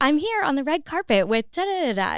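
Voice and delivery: chirpy TV presenter on the red carpet; movie surfer voice. Shown as a demonstration. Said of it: "I can't break my movie surfer voice".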